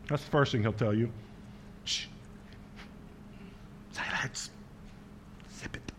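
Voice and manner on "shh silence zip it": unvoiced hush, very soft. God tells the complainer to zip it, whispered. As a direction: shush them like a librarian.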